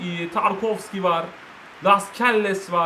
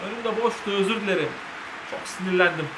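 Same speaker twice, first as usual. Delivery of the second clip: ordinary talk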